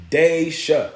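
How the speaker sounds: "Daisha" drawn out slowly as DAY-sha; confirmed pronunciation. Shown as a demonstration. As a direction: slowly sound out "Daisha", long ay. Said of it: "Okay, perfect".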